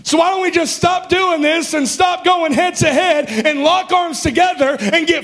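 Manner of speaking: shouted, high fired-up preaching; preaching at full force. Acted.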